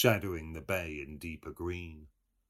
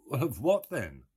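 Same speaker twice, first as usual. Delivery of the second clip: bright, louder mocking young man; Buck Mulligan voice; 8 semitones higher, 7 dB louder than usual. A narrator's reading. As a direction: Mulligan answering Stephen, raised and loud.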